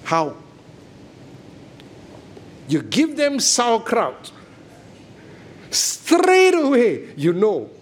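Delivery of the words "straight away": mock disgust. Sauerkraut test reaction.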